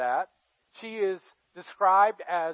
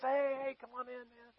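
high, soft "hey, hey"; lightly voiced sly host beckoning a guest; falsely friendly, fading invitation; coaxing mock welcome; 5 semitones higher, 8 dB quieter than usual. David beckons Uriah in again.

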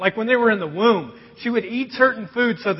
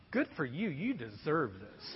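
cold satisfaction; 6 semitones lower, 18 dB quieter than usual. Retold or imitated. Dad's cold thoughts in moment.

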